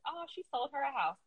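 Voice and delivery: ordinary talk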